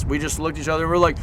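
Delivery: normal talking voice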